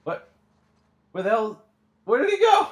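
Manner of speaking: high, loud baffled cry; shocked yell. Real, not acted. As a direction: yell in disbelief. Baffled as boat vanishes.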